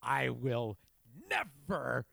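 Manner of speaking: drawn-out mock vow, word by word; hammed-up indignant declaration, slowing down; outraged gamer dude. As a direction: swear it slowly like an outraged fan. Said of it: "that one dude's like".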